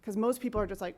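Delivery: ordinary talk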